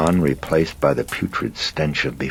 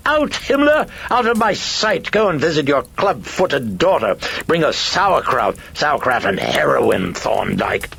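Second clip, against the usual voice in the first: very high, loud screeching, easing down toward the end; shrieked tirade; 14 semitones higher, 5 dB louder than usual. Acted. Shrieking personality orders Carl out of sight, furious screech. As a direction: screech insults to drive someone away.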